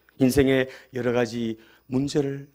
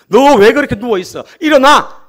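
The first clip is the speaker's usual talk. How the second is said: loud, high rebuke; scolding shout; 10 semitones higher, 12 dB louder than usual. Acted. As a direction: bark it at him like an angry God. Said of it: "이렇게 뭐라 그러지 않고". (누워 있는 엘리야를 꾸짖는 하나님의 높고 큰 고함)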